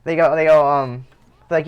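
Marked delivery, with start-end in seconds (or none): none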